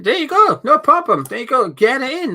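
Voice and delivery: high, loud celebratory encouragement; excited cheer. Real, not acted. Cheers viewer getting VPN working.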